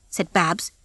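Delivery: ordinary delivery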